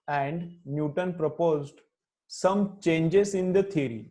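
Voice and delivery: slow, measured classroom dictation. Shown as a demonstration. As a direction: dictate phrase by phrase for students writing it down. Teacher dictates notes to students.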